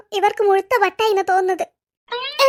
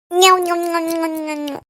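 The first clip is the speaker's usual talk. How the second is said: yummy munching, lower and fading away; munching noises; 5 semitones lower, much slower than usual. Acted. Aunty enjoying juice, munching sounds fading out. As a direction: smack lips savouring.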